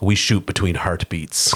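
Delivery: put-on low, slow Russian accent; deadpan Russian marksman accent. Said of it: "a Russian guy's like".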